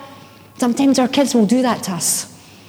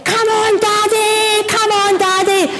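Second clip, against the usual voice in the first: high, drawn-out cheering yell; mock scream, pitched way up without full volume; retold screaming; 9 semitones higher, slower than usual. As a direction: cheer a runner on from the finish line. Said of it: "That's what we're screaming".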